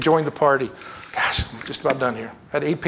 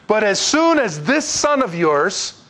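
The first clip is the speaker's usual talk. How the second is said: higher, slow, pointed disdain; with an attitude; 6 semitones higher, 4 dB louder, slower than usual. Acted. Older son refers to his brother before Dad, disdainful. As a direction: disown him through emphatic, stretched words. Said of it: "have it with an attitude".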